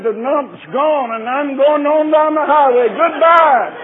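rising to a glad, loud, high shout of goodbye; exultant farewell cry; joyful shout. Retold or imitated. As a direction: call it out like a healed man leaving. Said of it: "And got up and shouted".

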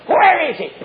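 acted outburst, pitched high; stirred-up king. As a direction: flare up as an enraged king. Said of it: "David got stirred up".

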